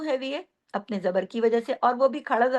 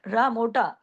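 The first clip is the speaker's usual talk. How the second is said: ordinary delivery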